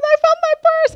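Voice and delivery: high, loud, thrilled exclamation; excited announcement. Retold or imitated. Wife calls husband about her found purse, excited shout. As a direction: blurt out the good news at full pitch.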